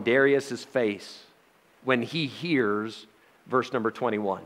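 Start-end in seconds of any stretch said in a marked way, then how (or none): none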